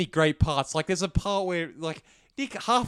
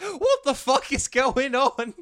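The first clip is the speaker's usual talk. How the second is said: much higher, louder, slow baffled cry; slow, high mock outrage at the screen; baffled viewer; 11 semitones higher, 7 dB louder, much slower than usual. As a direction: play a stunned first-time viewer. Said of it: "They're like".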